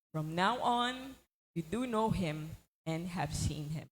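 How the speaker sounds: slow, measured Bible reading; scripture reading. Acted. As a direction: read the verses out with weight. Reads scripture aloud. (pagbasa ng Kasulatan nang mabagal)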